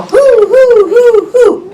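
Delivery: high, loud owl hoots; owl hooting. Pretend. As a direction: hoot like an owl. Owl sound in picture book.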